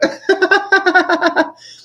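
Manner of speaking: loud, high burst of laughing; hearty laughter. Real, not acted. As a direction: crack up in amused disbelief. Keeps laughing at the answer.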